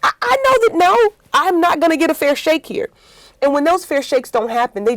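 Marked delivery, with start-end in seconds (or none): 0.0-2.9 high, gushing mock apology turning indignant; mocking fake sympathy. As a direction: parody a fake-sorry manager, pitch jumping higher. Mock quote of a dismissive manager.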